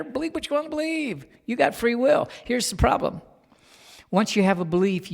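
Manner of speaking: full-throated pulpit preaching; preaching at full force. Acted. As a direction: drive it home to the congregation, building. Builds to a preaching shout.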